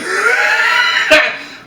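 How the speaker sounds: high cackling burst; real high laughing fit; hard laughing. Real, not acted. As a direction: crack up after your own punchline. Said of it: "I'm laughing so fucking hard".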